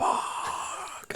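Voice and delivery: hushed, breathy curse under the breath; a panicked whisper to himself. Retold or imitated. To himself in his head, a whispered curse.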